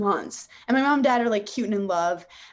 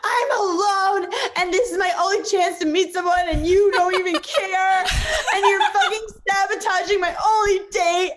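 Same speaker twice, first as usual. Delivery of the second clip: high, loud tearful outburst with a crack of laughter; to her dad about being left to miss her date, a tearful angry shout; snapping in anger; 10 semitones higher, 5 dB louder than usual. Retold or imitated. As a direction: yell it like she's finally lost it over the ruined date. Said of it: "I just snap".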